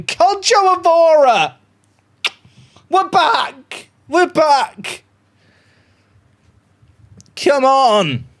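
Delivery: high, loud jubilant shout; yelling at the screen after a goal; goal celebration. Real, not acted. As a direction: scream the scorer's name in joy.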